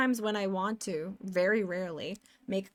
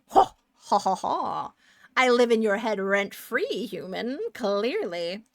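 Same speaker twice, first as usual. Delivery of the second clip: loud fake villain laugh, then a smug taunt; hammed-up triumphant gloat; mock gloating; 4 dB louder than usual. Acts out gloating at blocked person.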